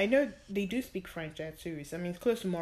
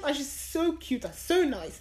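normal talking voice

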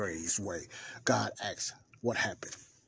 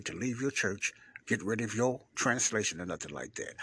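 normal talking voice

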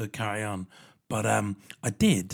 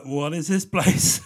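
slow, loud creeped-out question; a mock horror-film gasp of dread; spooked; 4 semitones higher, 8 dB louder, slower than usual. To the creepy toys in the window, spooked and slow.

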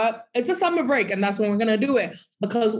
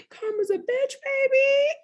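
high, mocking sing-song jab; sassy gloat. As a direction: toss it off with smug glee. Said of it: "all I'm gonna say is".

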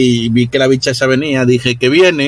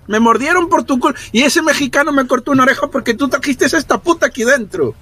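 pin the blame on him, pitched up; blaming rant; 12 semitones higher than usual. Acted. Bitten character blames Bogart for the woman, high and accusing.

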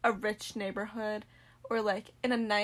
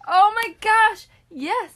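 much higher, loud, drawn out; excited agreement; 10 semitones higher, 13 dB louder, slower than usual. Real, not acted. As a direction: squeal it in recognition. Reacting to hated nickname.

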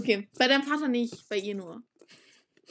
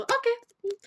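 gespieltes Okay; 13 semitones higher than usual. Retold or imitated. Imitiert eigene Reaktion.

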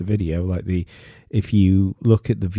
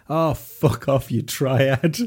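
retold shout; 5 semitones higher, much slower than usual. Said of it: "he shouts down".

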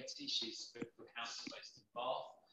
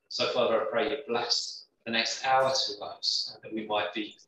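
full-voiced, much louder prayer to the Father; fervent public prayer, loud and steady; spoken prayer; 16 dB louder than usual. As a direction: pray aloud so the whole room hears. Leads the group in prayer.

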